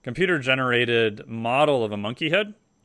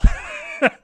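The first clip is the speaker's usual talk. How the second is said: breathy laugh; 5 dB louder than usual. Real, not acted. Laughs at own cheeky answer.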